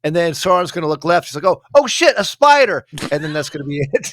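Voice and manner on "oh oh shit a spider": high, loud comic alarm; mock startled cry. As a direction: yelp it as a hammed-up fright. Acts out Sauron startled by spider.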